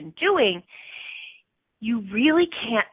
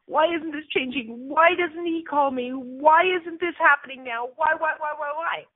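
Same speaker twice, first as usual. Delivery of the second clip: higher, whining plea; whiny why; 6 semitones higher than usual. Retold or imitated. Listener whining why to herself, higher and pleading. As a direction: nag like a frustrated inner voice. Voicing a frustrated inner voice.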